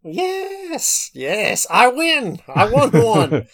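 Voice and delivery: drawn-out, high-pitched victory yes; triumphant gloating. Real, not acted. Co-host celebrating winning an episode, gleeful gloating. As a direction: crow playfully over a win.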